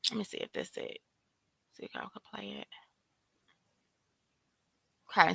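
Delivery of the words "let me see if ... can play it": a soft whisper to herself while fiddling with the clip; whispered aside. Real, not acted. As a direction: whisper it under your breath.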